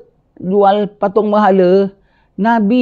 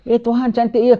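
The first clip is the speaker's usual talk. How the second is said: pujian berpura-pura, nada lebih tinggi; sindiran; 7 semitones higher than usual. Acted. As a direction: puji berhala sambil menyindir. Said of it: "dia perli kaum dia". (Ibrahim menyindir kaumnya dengan pujian palsu)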